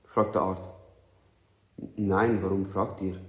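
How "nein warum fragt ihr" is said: ordinary delivery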